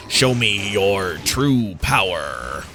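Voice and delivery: low, slow, hammed-up villain voice; cheesy villain line. Gamer quoting the general's cheesy boss line, low and slow. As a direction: growl it like a cheesy game boss. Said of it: "this really cheesy voice line".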